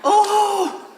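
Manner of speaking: over-the-top prim cussing, building to a screech; shrill prim oaths. Retold or imitated. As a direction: squeal tiny harmless curses in mock politeness. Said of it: "and you".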